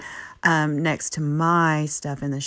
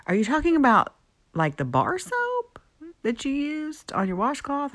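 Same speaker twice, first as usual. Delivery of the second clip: mock-incredulous, pitched way up and slowed; shocked disbelief; 11 semitones higher than usual. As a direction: play the friend's horror, voice shooting up high. Friend quoted, shocked at the soap.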